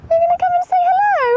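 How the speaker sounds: very high, loud coaxing call; calling to animal. Real, not acted. Owner invites Darcy to come over, high coaxing call. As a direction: coax the horse over in a high voice. Calling to horse across field.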